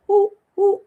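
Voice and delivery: high excited ooh; excited squeal. Real, not acted. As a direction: squeal over the ring. Excited about owl ring.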